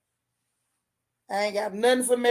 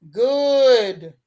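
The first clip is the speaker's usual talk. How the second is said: loud drawn-out good; 6 dB louder than usual. Real, not acted. Hyping the episode.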